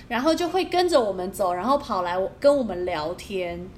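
ordinary talk, nothing marked

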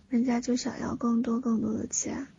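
put-on soft childish begging whisper; cutesy whiny pleading. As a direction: wheedle like a spoiled little kid. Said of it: "撒娇".

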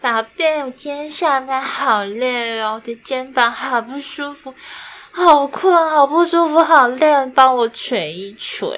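soft, slow, drawn-out pouty whine; whiny baby talk to a boyfriend. Shown as a demonstration. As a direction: complain sweetly so he rubs your shoulders. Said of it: "哎，示范。". (轻声慢慢地撒娇抱怨)

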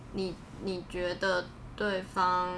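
usual speaking voice

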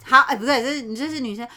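ordinary talk

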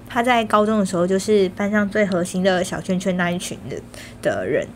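ordinary talk

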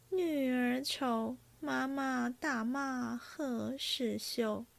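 slow, measured recital of a verse; verse recitation. A narrator's reading. Singing girl recites her drinking-game verse, slow recitation. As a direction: recite it line by line, drawing it out.

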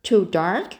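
mildly incredulous, back down in pitch; questioning echo. A narrator's reading. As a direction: throw it back as a doubtful question. Marilla echoes Anne in disbelief.

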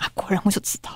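whispered knowing aside. Retold or imitated. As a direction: breathe it like a secret thought. Inner thought, whispered. (小声嘀咕)

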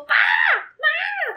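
calling out. Retold or imitated. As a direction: yell for mom and dad in alarm. Calling parents at a cockroach.

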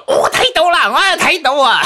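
high, loud indignant retort; mock indignant dad. The father scolding back at the mother, hammed-up high and loud. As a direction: snap back in a hammed-up huff. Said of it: "我爸罵回我媽說".

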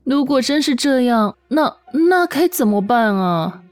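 normal talking voice

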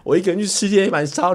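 ordinary talk, nothing marked

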